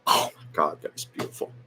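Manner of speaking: breathless whisper of awe; awed whisper. Real, not acted. Host remembering the final song, whispering in awe. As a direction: whisper it, moved.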